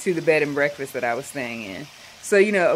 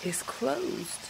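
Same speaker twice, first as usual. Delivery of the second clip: much softer, trailing off as he breaks bad news; soft hesitant news; 7 semitones higher, 8 dB quieter, much slower than usual. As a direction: let it fade apologetically. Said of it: "He said".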